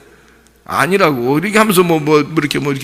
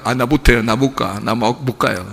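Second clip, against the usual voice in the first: low, shrinking protest, fading softer; reluctant Moses; 5 semitones lower, 5 dB quieter than usual. Retold or imitated. Moses, called by God after forty years, reluctant. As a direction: mumble your way out of being sent. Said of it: "하나님이 부르니까".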